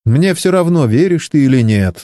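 rude retort. A narrator's reading. Young woman answers a doubting crewmate, mildly rude. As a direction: brush him off with understated rudeness. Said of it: "грубо ответила Чен".

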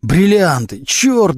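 high-pitched exasperated wail; anguished howl. A narrator's reading. Suspect confronted about stolen diamonds, howling in exasperation. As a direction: howl the curse in despair. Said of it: "взвыл Глущенко".

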